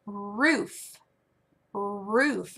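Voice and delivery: stretched-out word with a lingering first sound; careful teacher enunciation; modeling a word. Shown as a demonstration. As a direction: draw it out clearly for a learner.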